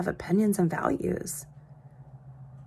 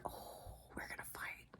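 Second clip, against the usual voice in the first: much softer whispered inner thought; whispered aside; 27 dB quieter than usual. Retold or imitated. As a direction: breathe it like a private dread. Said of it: "And I'm like".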